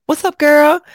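high, loud mock greeting; imitated catchphrase greeting. Greeting everybody as girl, imitated loud hello. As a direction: call it out high and punchy. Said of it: "I called everybody girl".

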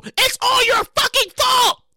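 drawn-out furious accusation; mock angry yell. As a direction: shout it at your sister. Blaming his sister.

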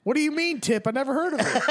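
high, perky Midwestern voice; North Dakota accent. Retold or imitated. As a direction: play a clueless rich lady from North Dakota. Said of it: "North Dakota voice for her".

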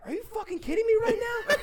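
very high-pitched, disbelieving comeback; retold incredulity. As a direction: act out being floored by the insult.

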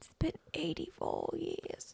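put-on high, slow whisper like a frail old woman; hammed-up old lady whisper; old lady meme whisper. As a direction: breathe it out high and slow, mock-ancient. Echoing the old movie meme.

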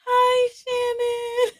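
high, slow, sing-song hello; gushing greeting. Real, not acted. Greeting friend Shannon who just joined, high drawn-out gush. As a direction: drawl her name out high with delight.

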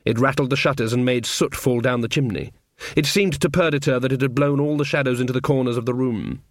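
ordinary delivery

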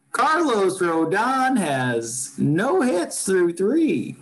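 high, slow, drawn-out play-by-play call; Len Kasper announcer voice. Retold or imitated. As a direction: stretch each word like a TV baseball announcer. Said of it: "in his very announcery voice".

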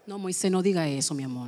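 soft, low coaxing voice; soothing therapist. Acted. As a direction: play a therapist gently hushing a patient. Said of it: "me convierto en un terapista". (terapista suave, en voz baja)

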